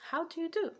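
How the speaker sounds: high-pitched, formal quoted greeting; mock Northern American. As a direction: jump way up for a prim Northern greeting. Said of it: "a Northern America will s-, say".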